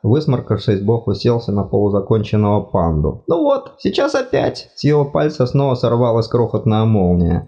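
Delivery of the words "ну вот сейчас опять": high fussy god voice, apologetic; flustered deity, much higher pitched; embarrassed god. Acted. God sparks again.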